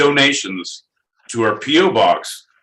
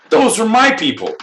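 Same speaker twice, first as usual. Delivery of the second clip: mock proud declaration, pitched up; mocking high voice; 9 semitones higher than usual. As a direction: parody a smug old-man fan. Mocking John's quote.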